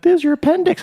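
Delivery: high chirpy joke voice; high mock doctor. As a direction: squeak it out like a jokey doctor. Doctor joking mid-procedure.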